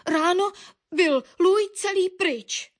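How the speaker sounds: high voice, slowing to emphasise; insistent bird. A narrator's reading. Bird tells Baron the fat vanished, high insistence.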